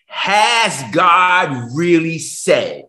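"Has" drawn out, very slow; a slow, doubting church voice; slow mocking question. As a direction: lay it out slowly, each word apart. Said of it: "church that says".

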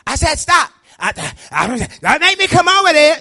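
high, very loud scolding yell; yelling parent. Acted. Preacher imitating parents whose kids won't listen, high yelling. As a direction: act out a parent yelling full blast at the kids. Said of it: "always yelling at the kids".

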